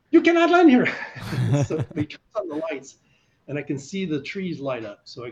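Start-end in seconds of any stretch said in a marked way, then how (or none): 0.1-0.9 much higher and louder, insistent; mock controller yell. Tower controller refusing the landing, high mock yell. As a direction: yell it down the radio in alarm.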